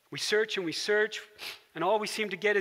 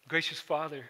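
closing prayer; 3 semitones lower than usual. Real, not acted. Pastor leads closing prayer.

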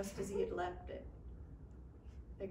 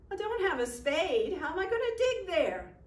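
exasperated; 8 semitones higher, 6 dB louder than usual. Acted. Michael protests no spade.

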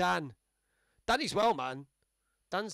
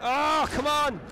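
frustrated shout; 8 semitones higher, 6 dB louder, much slower than usual. Real, not acted.

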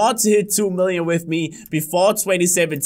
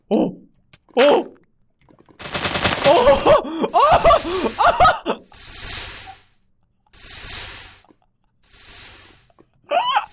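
rising, higher and higher shocked cries; yelling in real surprise; shocked yelps; 14 semitones higher, much slower than usual. Real, not acted. Explosion from unlucky box.

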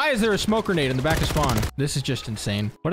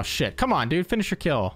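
ordinary delivery

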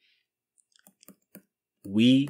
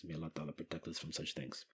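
fast, much softer murmured prayer; hushed prayer; 5 semitones lower, 20 dB quieter, faster than usual. Real, not acted. Teacher quietly praying after a dark verse. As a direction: drop to a quick whisper of a blessing.

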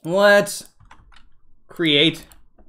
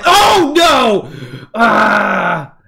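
startled shout; 2 semitones higher, 3 dB louder, much slower than usual. Real, not acted. Mob camping the player.

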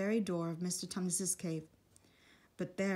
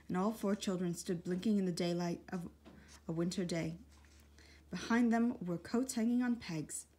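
ordinary delivery

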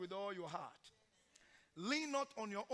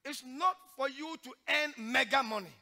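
loud, high preaching cry; preaching at full force; 5 semitones higher, 12 dB louder than usual. Acted. Preacher exhorting the church in a loud preaching cry. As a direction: drive each line home like a revival preacher.

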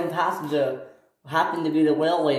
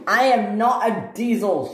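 raised, higher indignant protest; offended; 6 semitones higher than usual. A narrator's reading. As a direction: push back, mildly insulted. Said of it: "Caitlin was offended".

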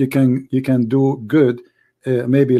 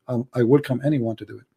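usual speaking voice

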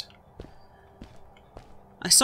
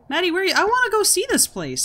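much higher, excited plea; eager pleading; 12 semitones higher than usual. Real, not acted. Player begging game character to go see the place, excited. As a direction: whine it high and eagerly.

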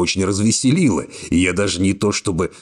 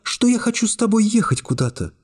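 put-on lightly raised young woman voice, cool and calm; young woman's voice. A narrator's reading. Secretary coolly refusing the hero's embrace, high young woman voice. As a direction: voice her higher, calmly distant. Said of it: "спросила она удивлённо".

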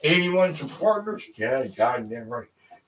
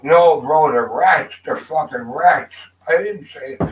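normal talking voice

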